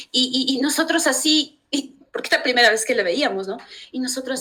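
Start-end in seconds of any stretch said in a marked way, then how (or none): none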